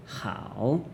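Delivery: scoop "hao" down and up; teacher tone. Shown as a demonstration. Teacher demonstrating the word good, "hao" dipping then rising. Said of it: "also teacher tone".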